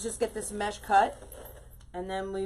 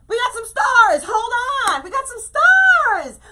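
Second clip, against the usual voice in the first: excited squeal; 12 dB louder than usual. Real, not acted. Excited over livestream stars.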